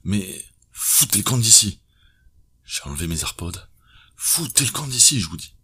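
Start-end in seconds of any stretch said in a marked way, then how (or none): 0.0-1.8 higher, louder furious yell; detective's hidden thought yelling at her; angry shout. A narrator's reading. 4.2-5.5 much higher, louder yell; detective actually yells at her to leave; shouted order. A narrator's reading.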